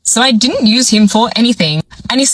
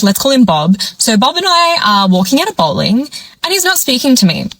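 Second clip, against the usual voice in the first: ordinary talk, nothing marked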